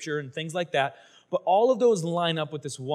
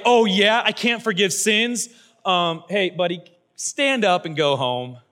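loud, higher, slow mock-innocent sarcasm; sarcastic Jesus; 4 semitones higher, 7 dB louder, slower than usual. Jesus mocking the Pharisees while healing the man, sarcastic. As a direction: tease the doubters with fake modesty.

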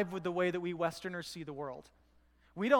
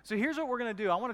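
raised, louder fatherly warning; imitated dad; 4 semitones higher, 4 dB louder than usual. Son imitates dad handing over car keys, a raised warning. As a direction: call out like a dad cautioning his kid. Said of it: "he, he goes".

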